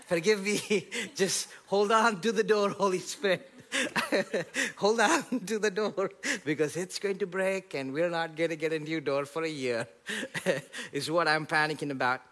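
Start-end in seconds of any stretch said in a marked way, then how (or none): 0.1-9.8 laughing through a panicked plea; half-laughing, pleading for the door; giggling mock panic. Real, not acted. Panicked prayer, laughing heard.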